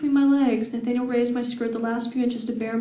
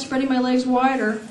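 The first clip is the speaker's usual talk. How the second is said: ordinary delivery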